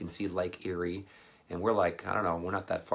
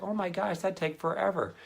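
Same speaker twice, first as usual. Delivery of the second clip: high mock exasperation; high exasperated; 11 semitones higher than usual. To the mom about the long walk, high mock exasperation. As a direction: sigh it out in comic dismay.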